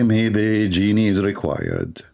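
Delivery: usual speaking voice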